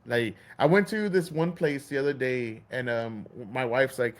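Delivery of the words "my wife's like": normal talking voice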